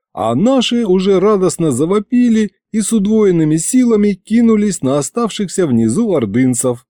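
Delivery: ordinary delivery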